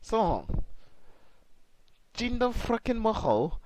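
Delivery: hammed-up Scottish wrestler's threats; attempted Scottish accent. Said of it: "I'm trying to do the Scottish accent".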